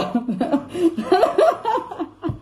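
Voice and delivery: long high giggle; helpless laughter. Real, not acted. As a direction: crack up laughing. Cracks up over the milk.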